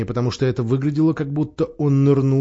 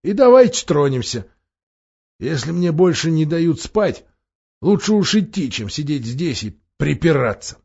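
raised, emphatic dwarf voice; impatient dwarf; 3 semitones higher than usual. A narrator's reading. Dwarf deprived of sleep urging the others to move, impatient. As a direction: urge them on with a put-on dwarf's impatience.

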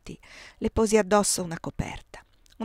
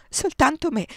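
desperate plea; 8 semitones higher, 6 dB louder than usual. A narrator's reading. Repeated frantic plea.

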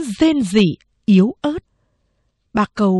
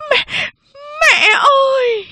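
slow wailing cry, much higher and louder; 3 dB louder, slower than usual. Acted. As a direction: scream for her mother through tears. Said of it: "Mẹ tôi bỗng gào lên". (gào khóc gọi mẹ)

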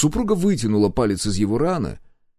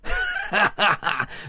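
high, wild guffaw; mad laughter; 8 semitones higher than usual. Acted. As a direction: captive laughing again. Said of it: "снова расхохотался он".

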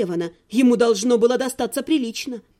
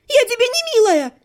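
high, loud and indignant; girl yells back at the necromancer; furious shout; 9 semitones higher, 5 dB louder than usual. A narrator's reading. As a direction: throw the endearment back at him. She snaps back hysterically.

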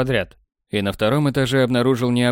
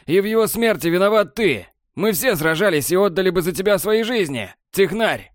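furious condemnation; 7 semitones higher than usual. A narrator's reading. Borland condemning Technar, high furious voice. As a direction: condemn him, full force.

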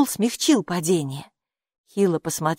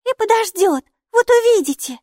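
high, loud little voice; squeaky, insistent small creature; defiant retort; 7 dB louder than usual. A narrator's reading. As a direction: fire back stubbornly, very high. Maika retorts to teasing.

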